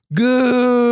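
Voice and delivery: long drawn-out cheer of GOOKER; mock crowd yell. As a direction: stretch the vowel like a crowd chant. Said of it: "they're yelling".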